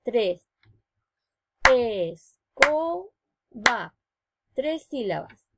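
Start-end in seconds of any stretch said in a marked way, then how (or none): 1.7-3.1 "Escoba" very slow, Es-co-ba; "Escoba" spoken very slowly, syllable by syllable; over enunciating. Shown as a demonstration. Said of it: "separando las sílabas con palmas".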